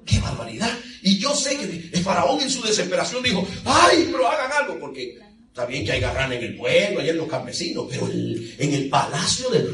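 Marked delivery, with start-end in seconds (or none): 3.6-4.8 higher, louder cry of a desperate pharaoh. Acted. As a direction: beg for someone to act.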